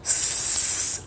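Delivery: unvoiced snake hiss; faint s, long and voiceless. Shown as a demonstration. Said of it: "the phonic sound is s".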